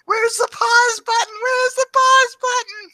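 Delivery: very high squeaky pleading; mock frantic falsetto. Man imitating teacher poking the growing kids, frantic falsetto. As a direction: whine it in exaggerated falsetto. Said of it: "You're poking them and going".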